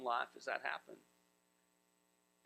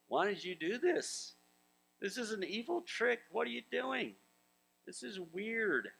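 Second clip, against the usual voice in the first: higher, mock-whining grumble; whiny complaining Israelites; 7 semitones higher than usual. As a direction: play the panicked grumblers, half in jest. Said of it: "They were like".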